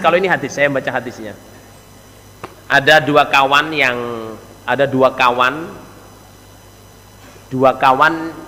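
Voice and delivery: ordinary talk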